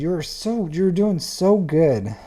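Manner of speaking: high, loud, drawn-out gushing; mock-tearful admiration; gushing praise. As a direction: overflow with delight at their ships. Gushing praise over viewer's ships.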